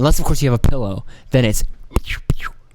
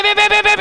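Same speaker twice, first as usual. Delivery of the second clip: rapid mock gunshots. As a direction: rattle off the shots fast.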